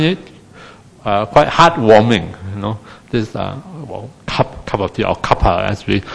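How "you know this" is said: ordinary talk, nothing marked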